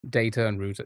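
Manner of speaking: Data as DAY-ter, router as ROO-ter; long a in Data, oo in router; his own English way. Shown as a demonstration. Streamer showing chat how he says them. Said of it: "that's how I say it".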